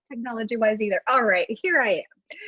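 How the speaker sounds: ordinary talk, nothing marked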